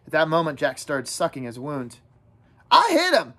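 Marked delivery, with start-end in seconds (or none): 2.7-3.3 put-on high, insistent boyish protest; indignant. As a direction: insist on your own deed. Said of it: "Ralph said indignantly".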